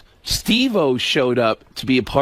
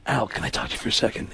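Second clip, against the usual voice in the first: hushed aside; 7 semitones lower, 8 dB quieter, faster than usual. Retold or imitated. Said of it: "and Alec goes".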